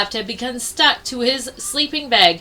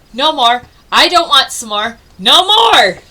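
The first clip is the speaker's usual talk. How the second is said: terrified bear crying out; 3 semitones higher, 8 dB louder than usual. Acted. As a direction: beg it to stop in a fright. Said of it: "the terrified bear cried out".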